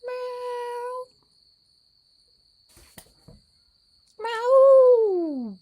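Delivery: high cat meows, the second loud and falling. Pretend. Vlogger meowing at the cats, high loud meows. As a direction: meow high like a cat calling.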